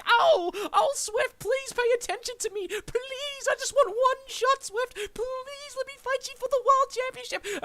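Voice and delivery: high, pleading mock whine; mock his opponent's begging in a high whine; whiny begging Brett. Wrestler mocking rival's begging, high whiny voice. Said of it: "He was like".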